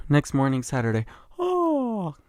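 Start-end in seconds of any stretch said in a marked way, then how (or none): 1.4-2.2 high, drawn-out waking noise; acted waking-up groan. Storyteller acting out waking on Saturday morning, waking-up groan. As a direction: stretch and moan high on waking.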